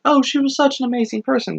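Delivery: high, quick imitation of gushing mourners; gushing mock praise. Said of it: "crying and praising her and being like".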